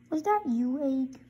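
ordinary delivery, nothing marked

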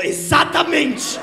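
loud, drawn-out exclamation; triumphant shout. Retold or imitated. Girlfriend answering Thiago, slow loud triumphant shout. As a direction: shout it out as a victory.

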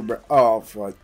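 usual speaking voice

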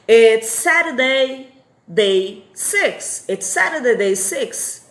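slow, firm model of It's Saturday; emphatic repeat-after-me answer; slow teaching demo. Shown as a demonstration. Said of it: "É sexta, dia cinco".

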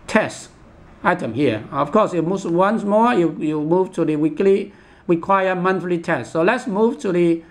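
normal talking voice

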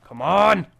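loud, drawn-out "come on"; frustrated urging. Real, not acted. Player urging on the game with a minute left, frustrated shout.

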